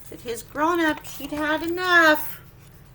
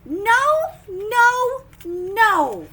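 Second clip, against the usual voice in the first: loud, high repeated no; firm shouted warning; scolding; 6 semitones higher, 8 dB louder, much slower than usual. Acted. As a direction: call out no, no, no like a parent stopping him. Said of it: "It says".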